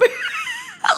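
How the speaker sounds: giddy screaming laugh; high shrieking laugh. Real, not acted. Overwhelmed laughter reading scene.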